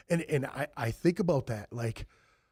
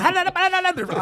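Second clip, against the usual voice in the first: loud, high-pitched gibberish babble; high mocking babble; mock foreign chatter; 8 dB louder than usual. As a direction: imitate rapid talk you can't understand. Mimicking Spanish chatter in game lobby.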